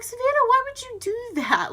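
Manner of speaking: very high mock mom reproach; imitated mom. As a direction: question her in an exaggerated high mom voice. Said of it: "She's like".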